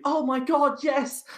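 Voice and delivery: high-pitched mock squeal of joy; thrilled contestant. Acts out contestant voted through.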